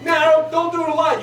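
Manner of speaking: ordinary talk, nothing marked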